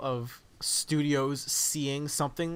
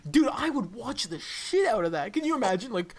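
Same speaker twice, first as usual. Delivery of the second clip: ordinary talk, nothing marked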